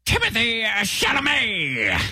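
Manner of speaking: high, drawn-out shout; shouted guess. Acted. Contestant blurts a game answer, long high shout. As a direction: call the name out high and slow.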